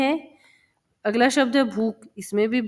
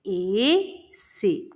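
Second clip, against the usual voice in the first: slow dictation; 2 semitones lower, 3 dB quieter, much slower than usual. Shown as a demonstration. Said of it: "spelling ऐसे slowly".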